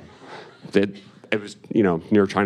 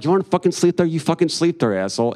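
angry retort; 6 semitones higher, faster than usual. Retold or imitated. To his drunk father who refuses to move, a furious higher snap. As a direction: spit it back at him in fury.

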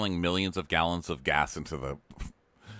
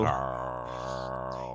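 very low, soft, drawn-out Carl; long shady drawl; 8 semitones lower, 6 dB quieter than usual. Pretend. Lauren throwing shade at Danielle, low drawn-out. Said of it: "one long".

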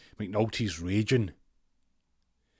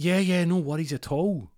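high casual reply; 9 semitones higher, 6 dB louder than usual. Retold or imitated. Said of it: "He's like".

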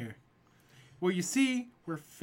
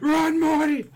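much louder high yell; loud shout; 11 semitones higher, 13 dB louder, slower than usual. Retold or imitated. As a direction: scream it out at full volume.

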